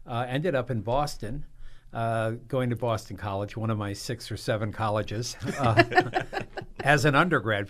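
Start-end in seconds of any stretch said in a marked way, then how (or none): 5.9-6.8 short high airy laugh; high breathy laugh. Real, not acted. Guest admitting many colleges, airy laugh. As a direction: laugh lightly at yourself.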